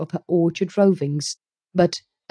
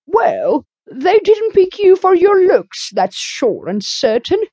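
loud, blunt and higher; emphatic; 11 semitones higher, 5 dB louder than usual. A narrator's reading. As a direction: say it flat out, no mercy. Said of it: "Missus Rachel Lynde's emphatic comment".

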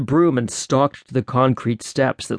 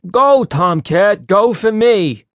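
higher, louder, slow pleading; mock begging, pitched up; 8 semitones higher, 4 dB louder, slower than usual. A narrator's reading. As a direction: beg on your knees, hammed-up. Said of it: "got down on his knees and begged".

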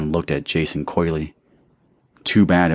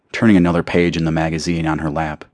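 usual speaking voice

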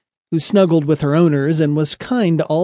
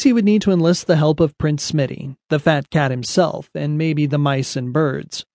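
usual speaking voice

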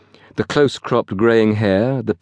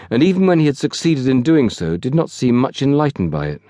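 ordinary delivery, nothing marked

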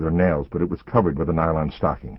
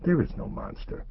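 whisper; 11 semitones higher, 7 dB quieter than usual. A narrator's reading. Mother soothing child at bedtime.

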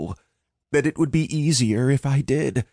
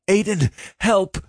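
high, desperate plea from far off; a drowning friend begs for help; cry for help; 9 semitones higher than usual. Acted.

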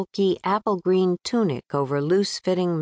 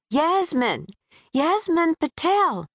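calling out; 11 semitones higher than usual. Acted. Calling after fleeing passenger.

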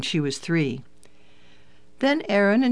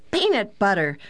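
mild narrated yell, pitched up; child's yell; 4 semitones higher than usual. A narrator's reading. Daughter answering father's grocery question, mild eager yell. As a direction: call it out higher, not full volume. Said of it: "Pam yelled".

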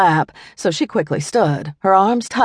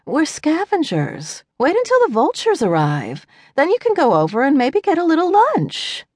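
wry she-jackal; 10 semitones higher than usual. A narrator's reading. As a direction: tease the kid with dry patience. She-jackal reminds boy, smirking.

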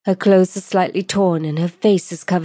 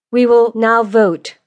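slow, louder, higher announcement; calling the vote; 5 semitones higher, 5 dB louder than usual. Acted. Lordess standing to address the lords, formal announcement. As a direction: announce it formally to the whole row.

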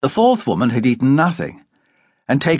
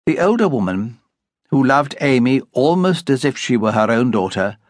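plain reading voice

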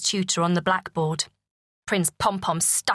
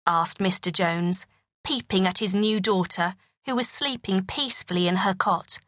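usual speaking voice

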